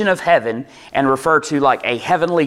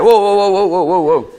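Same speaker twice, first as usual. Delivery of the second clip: louder hammed-up "whoa" to stop him; angel's alarmed whoa; 4 semitones higher, 3 dB louder than usual. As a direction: play the startled angel halting a worshipper. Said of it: "he's like".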